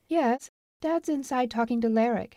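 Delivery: put-on young woman answering a caller; girl's voice. Marla greets the marshal, lifted girlish voice.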